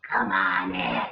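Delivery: very low, slow growly voice; a deep wolf badly faking Granny; gruff wolf.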